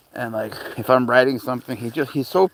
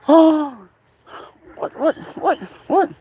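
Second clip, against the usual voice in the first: high-pitched mock gasp, then little quick whats; imitating startled dog; 4 dB louder, slower than usual. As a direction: act out a dog's stunned double take. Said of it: "He's like".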